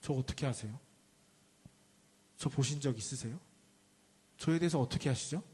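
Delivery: low, soft, earnest questioning; suddenly serious. Acted. Nathanael asks Jesus how he knows him, acted suddenly serious and hushed. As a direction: ask gravely and quietly, leaving room between questions. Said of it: "표정이 싹 진지해지면서 이렇게 물어봐요".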